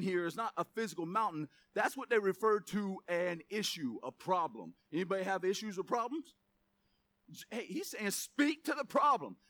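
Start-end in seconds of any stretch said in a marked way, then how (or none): none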